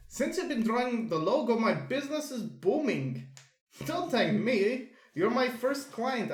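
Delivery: lightly put-on Russian accent. Shopkeeper thanking his logo artist, accidental Russian accent. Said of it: "he's getting Russian now".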